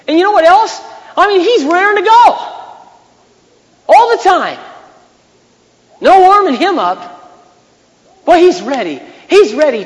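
full-force preacher shouting God's eagerness; excited preaching. Acted. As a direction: proclaim it like news too good to hold.

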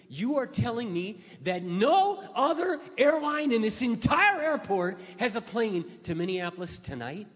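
furious traveler at the counter; indignant outburst. Retold or imitated. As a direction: lose patience with the agent. Angry disbelief at agent.

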